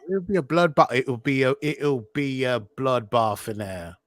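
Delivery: loud, stumbling Cockney attempt; botched Cockney accent. Host can't do the accent, wobbly Cockney imitation. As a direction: fumble through a bad Cockney accent. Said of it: "Whenever you find you Cockney, it just goes wrong".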